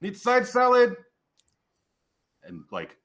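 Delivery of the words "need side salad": high, loud North Country holler; little high-pitched cook calling out; tiny yelling kitchen voice. Acted. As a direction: yell the order as a tiny voice from the kitchen. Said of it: "this tiny little voice from the kitchen, North Country voice, yelling".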